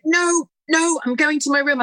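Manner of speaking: put-on high, sulky refusal of a girl storming off; huffy daughter. Daughter brushing past her mum.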